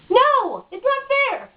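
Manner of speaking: sudden high, loud protest; indignant outburst. Real, not acted. Venting to the interviewer, a loud indignant cry. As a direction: burst out that it's not fair. Venting about unfair dating.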